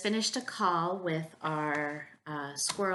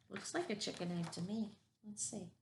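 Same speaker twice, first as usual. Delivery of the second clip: soft aside; 9 dB quieter than usual. Real, not acted.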